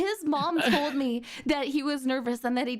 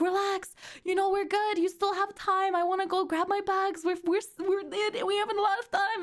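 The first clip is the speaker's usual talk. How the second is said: calm reassurance; 6 semitones higher than usual. Retold or imitated. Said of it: "And I was like".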